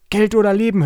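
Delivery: high, loud mock robber demand; robber's hold-up cry. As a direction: bellow it like a small stage bandit. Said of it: "eine kleine dunkle Gestalt".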